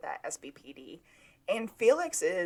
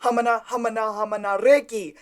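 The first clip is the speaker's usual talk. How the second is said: loud, hammed-up bad Spanish-style accent; frantic mock screaming in a bad accent; terrible accent; 4 semitones higher, 13 dB louder than usual. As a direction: shout names in a terrible accent, building to a high wail. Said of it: "do it in a terrible accent".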